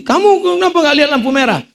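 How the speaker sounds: high-pitched mock reprimand; scolding policeman. Policeman stops driver at red light, acted scolding. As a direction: demand an answer as an indignant officer.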